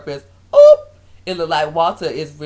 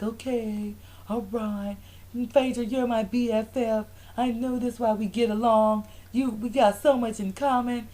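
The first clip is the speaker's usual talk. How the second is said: higher, small sweet voice; mock placated friend; 4 semitones higher, 3 dB quieter than usual. Imitating Kenya making up with Phaedra, sweetly.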